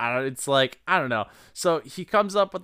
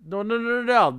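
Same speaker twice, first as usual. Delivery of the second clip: high protest; 10 semitones higher, faster than usual. Real, not acted. Rejecting the plan.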